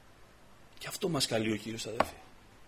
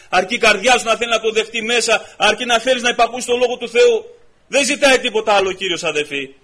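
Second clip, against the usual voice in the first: louder and higher with each repeated line; fervent preaching building; 8 semitones higher, 13 dB louder than usual. Acted. Preacher telling the brothers how much the Lord loves them, building loud preaching.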